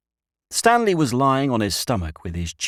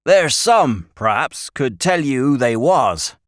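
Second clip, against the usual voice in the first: loud, higher rustic voice; old country shepherd; 5 semitones higher, 6 dB louder than usual. A narrator's reading. Shepherd telling the boys nobody uses the well, emphatic rustic. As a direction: tell them firmly in country speech.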